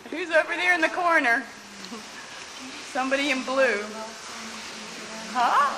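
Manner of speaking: cheerful call over the noise. Real, not acted. Calling across the kitchen.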